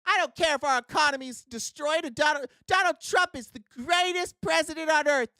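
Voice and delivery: high, shouting caricature of a devoted voter; hammed-up zealot yelling his loyalty; mock Trump voter. Mocking Trump voters who don't care, high hammed-up shout.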